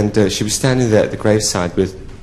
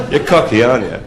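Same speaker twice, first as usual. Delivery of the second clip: ordinary talk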